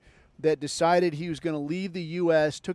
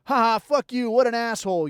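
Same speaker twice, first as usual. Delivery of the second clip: louder, higher mock jeer at the soldier; jeering mockery; 5 semitones higher, 5 dB louder than usual. Imitating online commenters jeering at the dead soldier, loud mocking. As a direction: sneer it like an online troll gloating. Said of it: "all of this stuff that's like".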